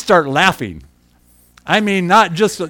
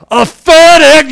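big, slow, higher shout of the punchline; shouted reveal; 10 semitones higher, 12 dB louder, slower than usual. Acted. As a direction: hurl "A FedEx" out to the room, drawn out. Punchline of the reflection story.

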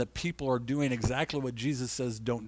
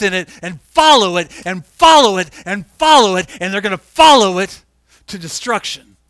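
louder, higher, building to a shouting climax; fiery preaching; 5 semitones higher, 11 dB louder than usual. Acted. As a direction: preach it hard, warning of what's coming. Preaching builds to full force.

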